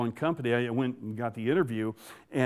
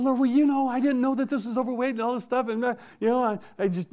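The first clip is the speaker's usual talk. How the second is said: much higher, hurried defensive babble; high-pitched excuse-making; 10 semitones higher, faster than usual. Retold or imitated. Young trucker justifying himself to the Lord, high-pitched excuses. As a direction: squirm out of it in a high, rushed voice.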